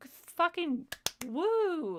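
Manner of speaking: high, very slow mock cheer; sarcastic woo. Real, not acted. Sarcastic cheer after mock quote.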